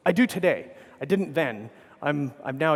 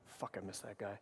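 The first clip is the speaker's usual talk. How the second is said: much softer, a sincere pang of missing him; quiet grief; 6 semitones lower, 19 dB quieter than usual. Real, not acted. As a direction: let it drop almost to nothing, real loss. Remembering late hero Jim Weirich.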